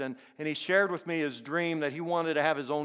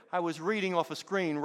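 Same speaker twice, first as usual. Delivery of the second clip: ordinary talk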